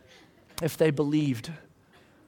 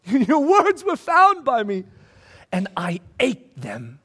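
high, loud, slow and awed; rapturous quote; 5 semitones higher, 5 dB louder, slower than usual. Acted. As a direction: savor each word like a feast. Ecstatic reread of quote.

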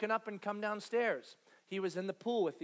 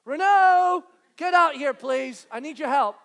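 loud, higher urgent calls; calling for help; 5 semitones higher, 13 dB louder than usual. Retold or imitated. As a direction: shout for the husband to come help. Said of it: "And then I hear".

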